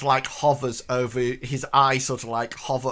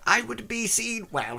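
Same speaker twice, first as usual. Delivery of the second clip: put-on old woman; 10 semitones higher than usual. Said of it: "She says".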